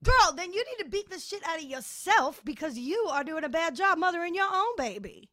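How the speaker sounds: loud, high, sassy call-out; sassy scolding. Real, not acted. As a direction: tell her off, full of sass. Scolding a TV mother.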